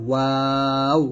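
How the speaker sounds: ওয়াও long, lips rounded and mouth open; slow, open-mouthed demonstration of ওয়াও; round-lipped waw. Shown as a demonstration. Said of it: "দুই ঠোঁট গোল করিয়া মুখ খোলা রেখে".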